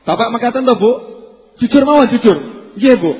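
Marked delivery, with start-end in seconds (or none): 1.6-3.1 shouted honest reply; loud call. Retold or imitated. Bapak menjawab ibu. (seruan keras)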